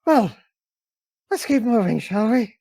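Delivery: high, light smiling suggestion; smiling companion. Acted. Devada turns to the party, smiling brightly. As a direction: pitch it up with a smile. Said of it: "Devada just smiles like".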